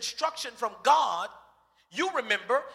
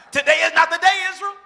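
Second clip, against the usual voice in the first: higher, much louder shout at Israel; furious shout; 6 semitones higher, 10 dB louder, faster than usual. Pretend. Moses warns Israel, loud furious shout. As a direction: yell it as the last warning.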